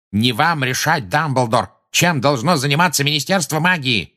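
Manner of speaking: indignant official pulling rank; angry bark. A narrator's reading. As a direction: bark it at a rival to shut them up. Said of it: "рявкнул Фадж".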